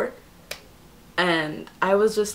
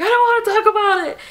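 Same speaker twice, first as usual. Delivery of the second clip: very high, loud whine, hammed-up; mock tearful wail; 6 dB louder, faster than usual. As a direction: wail it like an overwrought retelling. Acts out her tearful reply.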